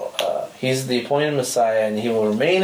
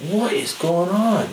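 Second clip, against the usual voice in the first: amazed disbelief; 6 semitones higher, slower than usual. Retold or imitated. Healed guy amazed, kicking ball.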